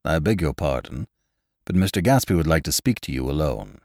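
low-pitched, discreet butler voice. A narrator's reading. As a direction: deliver the message as a butler. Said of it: "Gatsby's butler was suddenly standing beside us".

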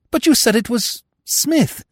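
high-pitched bewildered protest; squeaky-high puzzled young fool; baffled Freddy. Acted.

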